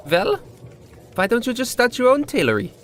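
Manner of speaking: put-on high, friendly old barkeep voice; friendly old bartender. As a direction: offer it like a kindly barman. Bartender voice, much higher.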